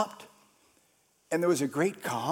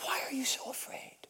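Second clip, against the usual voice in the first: whisper; 4 semitones higher, 14 dB quieter, faster than usual. Acted. Repeats question whispered.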